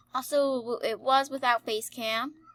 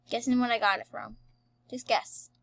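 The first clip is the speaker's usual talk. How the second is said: ordinary talk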